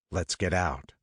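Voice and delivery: ordinary delivery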